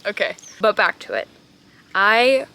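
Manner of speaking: ordinary delivery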